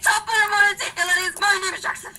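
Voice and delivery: put-on Irish accent. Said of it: "I can't do anybody's voices".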